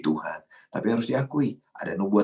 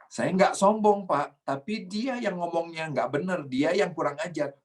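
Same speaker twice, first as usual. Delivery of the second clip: higher-pitched, mock-aggrieved denial; defensive protest; 8 semitones higher than usual. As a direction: plead your case, pitched up, blaming the other person. Acted self-defence blaming someone else. (membela diri dengan nada tinggi)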